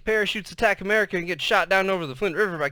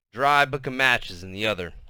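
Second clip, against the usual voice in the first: plain reading voice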